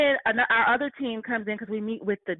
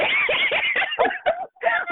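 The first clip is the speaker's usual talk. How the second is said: shrieking high laugh; 4 dB louder than usual. Real, not acted. Laughing while retelling the HR lady's laugh, high shriek. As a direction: let a big high laugh out.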